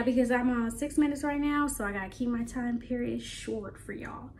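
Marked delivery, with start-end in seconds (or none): none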